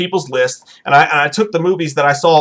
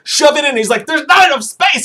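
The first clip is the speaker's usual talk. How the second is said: panicked soldiers; 8 semitones higher than usual. Retold or imitated. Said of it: "He was like".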